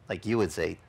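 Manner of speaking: normal talking voice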